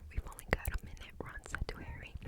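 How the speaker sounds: ordinary delivery, nothing marked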